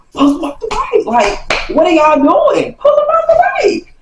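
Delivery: very high, squeaky urgent plea; urgent covert cue. Retold or imitated. Kid cueing frozen friends to grab him, urgent squeak. Signalling frozen helpers behind his back.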